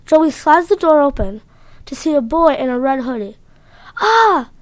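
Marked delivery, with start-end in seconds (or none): 4.0-4.5 high startled yelp, a bit louder; scream. Acted. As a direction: cry out in fright. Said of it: "screamed the boy".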